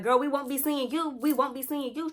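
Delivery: loud, high, heated telling-off; angry telling-off. Retold or imitated. Tasha going off on Crazy, loud and heated. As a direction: tell her off, voice up high.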